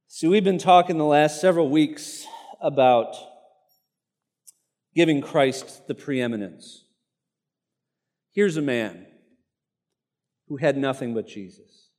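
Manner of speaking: ordinary talk, nothing marked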